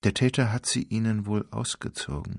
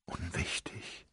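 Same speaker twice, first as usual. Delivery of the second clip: barely audible whisper; a hushed brush-off; whispered dismissal; 12 dB quieter than usual. Acted. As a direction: let it fall away, unvoiced. Brushing question off.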